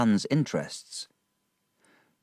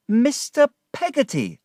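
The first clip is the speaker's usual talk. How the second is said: high delighted call; glad cry; 5 dB louder than usual. A narrator's reading. David greets Peggotty outside church, high glad cry. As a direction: call out in happy surprise. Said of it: "I cried".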